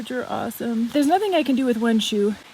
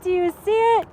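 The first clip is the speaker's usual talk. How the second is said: excited repeat; 8 semitones higher, 4 dB louder than usual. Real, not acted. Pressing the viewers again, high and eager. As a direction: still thrilled, pressing the point.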